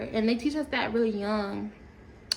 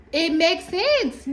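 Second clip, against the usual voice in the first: mock emphatic; 7 semitones higher, 7 dB louder, much slower than usual. High loud drawn out.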